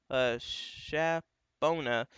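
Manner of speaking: "Shapona" drawn out very slowly, syllable by syllable; slow, tentative "Shapona" as if reading it for the first time; careful guess at a name. Real, not acted. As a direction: sound out "Shapona" slowly, unsure of it. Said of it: "I guess that's how you pronounce it".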